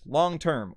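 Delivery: long term with weight on both words; double stress. Shown as a demonstration. Teacher repeats the example, long term with two stresses. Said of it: "two stresses".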